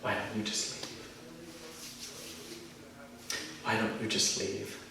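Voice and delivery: very soft, drained question; spent, quiet plea. Acted. Speaker asking them to leave after outburst, quiet. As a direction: let the voice fall right down after screaming.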